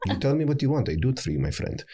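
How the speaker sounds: eager-to-oblige Middle Eastern accent. Shown as a demonstration. Actor demonstrating an accent he learned, Middle Eastern accent. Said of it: "learned a Middle Eastern act-, accent, like".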